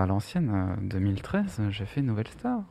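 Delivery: owning up under her breath; said softly. Real, not acted. Admitting to friends about Nouvelle Star, softly. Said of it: "Je le dis tout doucement".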